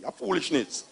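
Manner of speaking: ordinary talk, nothing marked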